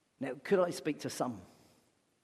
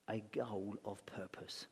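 softer, whispered repeat; 6 semitones lower, 11 dB quieter, slower than usual. Shown as a demonstration. Pastor repeating the key phrase to the congregation, whispered. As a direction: drop to a whisper to let it land. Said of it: "same words said before plainly".

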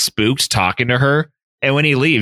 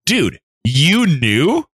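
slow, higher, drawn-out disbelief; mock astonishment; 5 semitones higher, much slower than usual. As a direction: stretch it out as an incredulous question. Onlookers astonished at Poirot.

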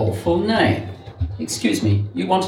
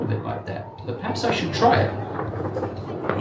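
musing whisper; 7 semitones higher, 6 dB quieter than usual. Real, not acted. Musing aside to himself.

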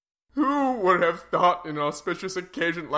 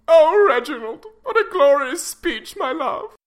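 shrill, fawning society wife; fawning high wife voice; 12 semitones higher, 7 dB louder than usual. Acted. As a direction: gush over him, high and loud. Wife fawns over husband.

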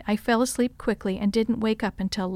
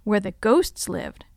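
ordinary delivery

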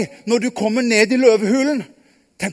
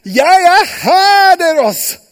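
high, loud, slow call; 8 semitones higher, 8 dB louder, slower than usual. Acted. Daniel calls up to the king, loud and slow. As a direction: shout it up out of the pit.

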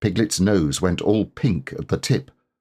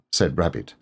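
ordinary delivery

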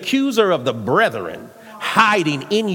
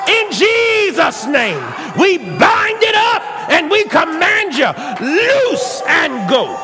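roaring rallying speech; 8 semitones higher than usual. Acted. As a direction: rebuke the spirits at full force. Shouting prayer of rebuke.

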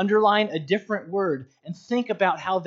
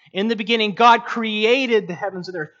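"created" stretched and punched loud; verse reading with all the weight on "created"; stress on CREATED; 3 semitones higher, 5 dB louder than usual. Shown as a demonstration. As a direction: hit "created" hard after a quicker start. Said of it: "emphasize different words".

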